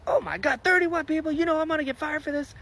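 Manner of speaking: high, rising, frantic alarm; he sees his career ending; hammed-up high panic; panicked constable. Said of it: "And he's like".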